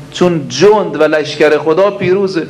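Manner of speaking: ordinary talk, nothing marked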